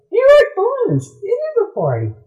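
very high, squeaky, excited; excited party fish. Retold or imitated. Fish spotting party balloons, very high squeaky delight. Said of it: "They're like".

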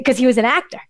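normal talking voice

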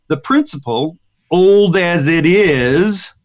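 put-on "old as it is" stretched very slowly and leaned on; emphasized words. Shown as a demonstration. Host reading the author's line, slowly stressing "old as it is". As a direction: drag out "old as it is" to make the point. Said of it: "I'll emphasize the words".

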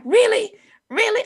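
high, loud repeated question; incredulous. Retold or imitated. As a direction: mimic a nephew's disbelief, loud and high. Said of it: "he was like".

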